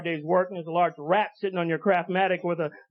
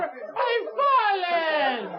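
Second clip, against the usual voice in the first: put-on slow, high, whining old woman wail; obnoxious old lady; 7 semitones higher, much slower than usual. Comic repeating the commercial lady's line, slow high whine. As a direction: stretch it out, helpless and pitiful. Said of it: "She just keeps saying it over".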